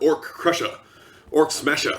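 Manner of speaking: ordinary talk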